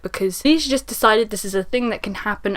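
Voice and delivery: ordinary talk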